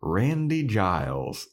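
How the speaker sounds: usual speaking voice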